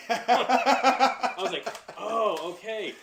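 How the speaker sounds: laughing through the words. Real, not acted. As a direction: crack up while saying it. Laughing retelling of tax shock.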